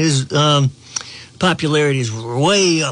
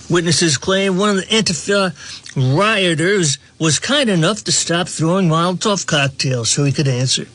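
normal talking voice

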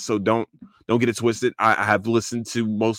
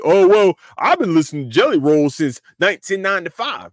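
mock snobby fan; 6 semitones higher, 6 dB louder than usual. Mocking a gatekeeping fan.

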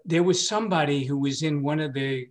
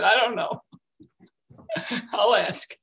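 laughing reluctance; 7 semitones higher than usual. Retold or imitated. Laughing reply to wife.

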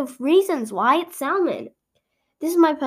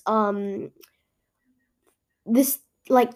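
ordinary talk

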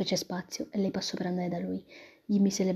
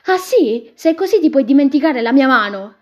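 much higher, much louder outburst; furious shout; 5 semitones higher, 13 dB louder than usual. Acted. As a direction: hurl it at him in anger. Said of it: "gli urlò contro".

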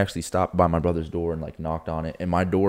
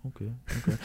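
meek thief imitation; 6 semitones higher, 9 dB quieter, faster than usual. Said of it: "He's like".

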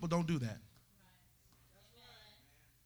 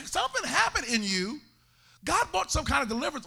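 usual speaking voice

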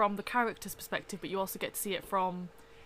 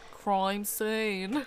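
drawn-out, hammed-up Austin Powers voice; imitating a friend's Austin Powers delivery; Brogan impression; 5 dB louder than usual. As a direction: stretch it out like a full Austin Powers take-off. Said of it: "the way she said crime scene was just brilliant, like, full Austin Powers".

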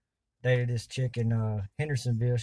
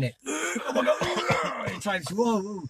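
mock gagging; 7 semitones higher, much slower than usual. Reacting to horrendous smell.

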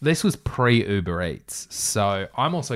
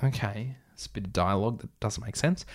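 ordinary talk

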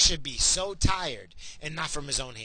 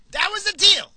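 repeated far higher and much louder; emphatic shout; 13 dB louder than usual. Real, not acted. Ranting to listeners about the NATO deal, shouting. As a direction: hammer the point home with a yell. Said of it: "repeats the deal, louder".

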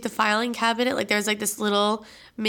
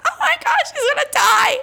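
much higher, louder, laughing imitation of a frantic cry; mock panic; 5 dB louder than usual. Pregnant aunt panicking as mom is choked, mimicked much higher. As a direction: squeal it like the terrified pregnant aunt. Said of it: "She's like".

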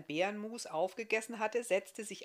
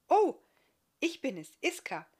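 higher, louder bright female reply; young woman answering; 8 semitones higher, 9 dB louder than usual. Acted. Iska answering the guest's greeting, high and friendly.

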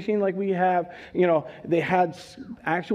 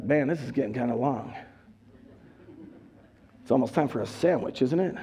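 lower, softer, fast inner grumble; bored churchgoer; 6 semitones lower, 4 dB quieter, faster than usual. Acted. Preacher imagining a bored congregant's thoughts during the sermon, low and quick. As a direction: mumble like a bored listener thinking of lunch.

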